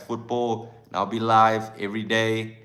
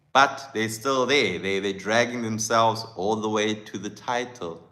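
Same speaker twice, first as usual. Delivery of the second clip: usual speaking voice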